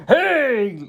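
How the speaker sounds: high villain call-out; hammed-up high greeting; Garmadon. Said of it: "And then he's like".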